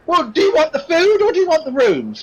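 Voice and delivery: put-on high-pitched, put-upon serving girl; exasperated barmaid. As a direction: press impatient questions on a vague customer. Voices the barmaid.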